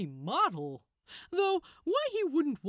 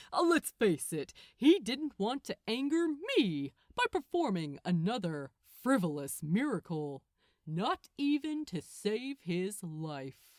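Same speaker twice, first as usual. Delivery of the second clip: usual speaking voice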